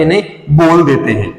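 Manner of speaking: normal talking voice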